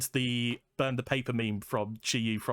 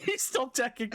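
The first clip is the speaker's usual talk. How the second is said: said through real laughter, much higher; giggling the line back; cracking up mid-echo; laughing; 11 semitones higher than usual. Real, not acted. Echoing the joke while laughing.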